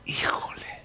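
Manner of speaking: whispered misgiving, airy and drawn back; hesitant inner voice. Acted. Preacher voicing the listener's doubting inner voice, whispered. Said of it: "Esa voz dentro de ti que te está diciendo".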